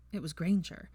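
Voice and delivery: plain reading voice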